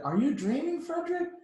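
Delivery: high, pointed reproachful question. A narrator's reading. Mice scolding Frederick for dreaming, high and reproachful. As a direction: chide him for slacking. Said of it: "they asked reproachfully".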